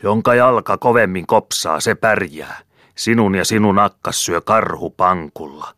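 high, loud furious roar. A narrator's reading. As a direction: bellow it at him, spitting with rage. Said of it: "sylki ja karjui".

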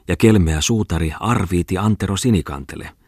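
plain reading voice

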